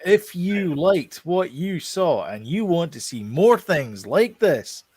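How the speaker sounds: slow, swooping singsong sign-off; hammed-up outro. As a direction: stretch each phrase with big rises and falls.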